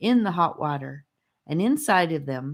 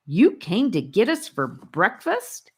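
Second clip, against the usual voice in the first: indignant; 5 semitones higher than usual. A narrator's reading. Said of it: "asked Charlie indignantly".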